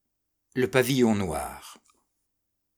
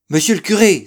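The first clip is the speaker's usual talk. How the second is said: loud, high-pitched hail; crying out; 9 semitones higher, 12 dB louder, faster than usual. A narrator's reading. Old sailor hailing the priest before mass, crying out. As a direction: call out urgently to stop someone. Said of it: "s'écria-t-il".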